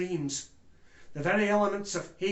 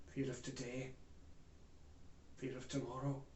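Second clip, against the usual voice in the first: very soft and low; grave, subdued listing; hushed dread; 4 semitones lower, 16 dB quieter than usual. Acted. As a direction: let each fear fall quietly. Testimony on the nation's fear.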